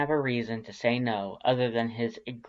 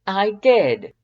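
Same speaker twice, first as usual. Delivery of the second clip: high, loud proud skeleton; proud insistence; 9 semitones higher, 12 dB louder than usual. Acted. Papyrus insisting to Dance, high and loud. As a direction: declare it proudly.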